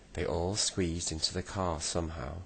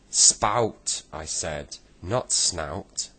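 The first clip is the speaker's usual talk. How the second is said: loud, cross correction; 8 dB louder, much slower than usual. A narrator's reading. Joe corrects Saucepan about the kettle, loud and cross. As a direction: snap SPOUT at him in irritation. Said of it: "said Joe crossly".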